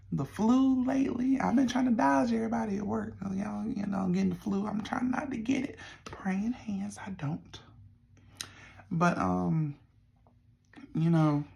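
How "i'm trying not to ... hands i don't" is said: whispering. Real, not acted. Whispered aside about avoiding flu.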